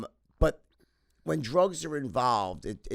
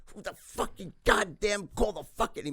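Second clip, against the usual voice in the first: slow, higher mock furious swearing; mock cursing rage; 7 semitones higher than usual. Dad cursing at the phone call, mildly imitated. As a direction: grumble out curses at the ringing phone.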